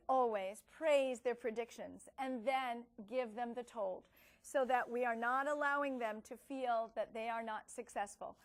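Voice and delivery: normal talking voice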